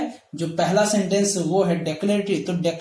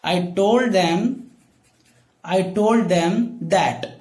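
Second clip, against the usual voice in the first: each word drawn out for writing; दिक्टेशन की तरह "I told them that" को बहुत धीरे बोलिए; slow dictation; 2 semitones lower, much slower than usual. Shown as a demonstration. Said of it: "teacher dictates sentence". (धीमी dictation आवाज़)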